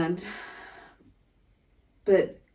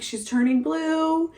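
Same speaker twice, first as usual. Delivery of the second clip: slow, much higher, tense report; alarmed; 10 semitones higher, 4 dB louder, slower than usual. Retold or imitated. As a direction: imitate his rising panic. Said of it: "And Byron's like".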